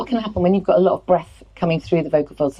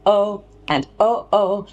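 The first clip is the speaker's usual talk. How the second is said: higher, slightly pushed "oh"; pushed vocal drill; a bit pushed; 4 semitones higher, much slower than usual. Shown as a demonstration. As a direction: push each onset a little. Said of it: "the second one is a bit pushed".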